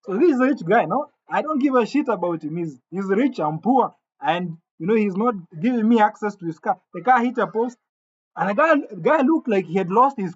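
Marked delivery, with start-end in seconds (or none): none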